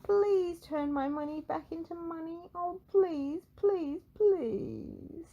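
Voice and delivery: high pleading voice; begging. Acted. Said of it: "he begged".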